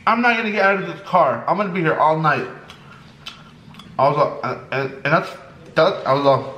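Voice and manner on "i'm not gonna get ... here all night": mock loud. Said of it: "this dude starts to get loud".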